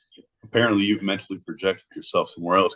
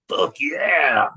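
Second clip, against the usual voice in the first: higher, hollered hype cheer; hyped cheer; 7 semitones higher than usual. Pretend. Comic boasting about his ninja-filled plane, hyped cheer. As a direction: whoop it like a monster truck fan.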